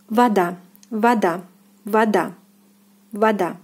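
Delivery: "vada" said va-DA, stress on the second syllable; "vada" with both vowels ah, end stressed; Russian pronunciation. Shown as a demonstration. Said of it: "stress on the second syllable as well".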